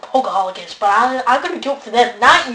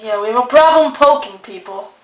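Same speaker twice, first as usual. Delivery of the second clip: normal talking voice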